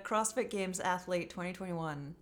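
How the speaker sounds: ordinary delivery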